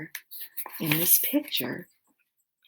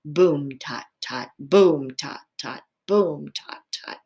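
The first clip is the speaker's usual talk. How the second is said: imitated drumbeat; 4 semitones lower, 3 dB louder, slower than usual. A narrator's reading. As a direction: beat it out like a drum. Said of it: "two staccato beats with his paws".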